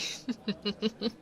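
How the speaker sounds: short teasing chuckle; a quick run of soft laughs; light giggle. Acted. Orc woman teasing a blushing human, light giggle.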